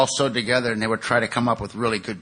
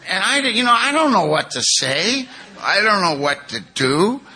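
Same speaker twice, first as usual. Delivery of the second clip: acted helplessness; 7 semitones higher, 4 dB louder than usual. Husband floored by wife's confession, helpless. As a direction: throw it up high, at a loss.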